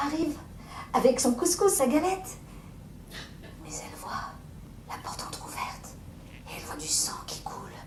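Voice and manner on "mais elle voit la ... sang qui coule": soft dread whisper; hushed horror; horrified whisper. A narrator's reading. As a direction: whisper what she sees. Horrified discovery of blood.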